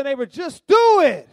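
high shouted call to the church; booming call to repeat after him. Acted. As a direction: shout it out to the congregation. Preacher calls congregation to repeat.

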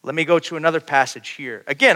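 normal talking voice

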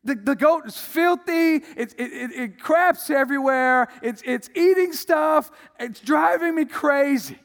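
high, loud exasperated rant; ticked off. Retold or imitated. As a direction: rant about the goat, fed up.

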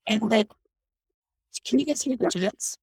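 usual speaking voice

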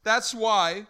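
loud, high, fervent sermon delivery; impassioned preaching. Real, not acted. Preacher telling congregation about shared joy, loud fervent preaching. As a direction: drive each phrase home to the congregation.

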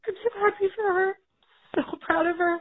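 happy words pushed out through crying. Real, not acted. Mom telling the podcast hosts she's proud, crying. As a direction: voice cracking with real tears. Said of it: "I am having a breakdown".

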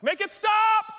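high, loud cry of desperation; desperate shout. Acted. Churchgoer in upheaval begs God, desperate shout. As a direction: shout it out, begging for relief.

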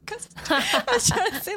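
high, loud laughing explanation. Real, not acted. Explaining a slip to the co-host, laughing. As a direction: talk through a burst of laughter.

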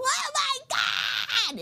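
drawn-out high cry of disgust; retold horrified shout; mock shocked cry. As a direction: wail it slowly like the shot burned. Guy spitting out the shot.

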